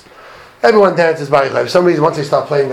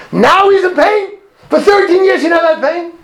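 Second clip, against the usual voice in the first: incredulous question; 13 semitones higher than usual. Retold or imitated. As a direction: question it in high-pitched disbelief. Rhetorical challenge to the accepted explanation.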